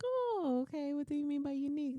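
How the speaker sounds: high-pitched, quick wondering reply; lightly acted high "oh, okay?"; puzzled. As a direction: imitate a naive, curious newcomer. New hire puzzled by coworkers' hint.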